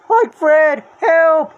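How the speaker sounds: loud, high cry for help; Shaggy calling Fred for help; called for help. Acted. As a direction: shout across the fairground. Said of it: "Shaggy called".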